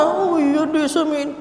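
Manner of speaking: high mock giving-in; imitated compliant husband. Husband giving in to his wife, mock compliance.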